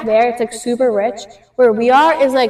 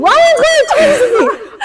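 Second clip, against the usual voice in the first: very high, squealed news with laughter; excited scream; 13 semitones higher, 4 dB louder than usual. Retold or imitated. Girl screaming to mom about Ryan joining trip, excited. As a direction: shriek it through a laugh. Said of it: "I scream".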